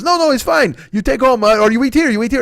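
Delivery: put-on high, eager accented old deli man; high eager voice of the old deli owner pushing a huge sandwich. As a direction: gush like a proud old shopkeeper. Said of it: "And he goes".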